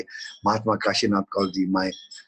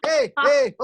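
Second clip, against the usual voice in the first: high, louder joyful cry; cheering; 8 dB louder than usual. Acted. Teacher cheering the laughing class, excited. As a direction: cheer them on.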